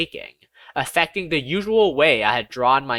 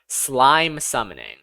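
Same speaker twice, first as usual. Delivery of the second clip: ordinary delivery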